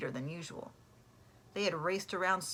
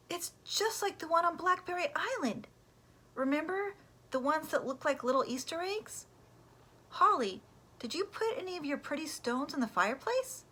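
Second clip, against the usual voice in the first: excited Pam; 10 semitones higher, 4 dB louder than usual. Acted. Pam excited about the stone.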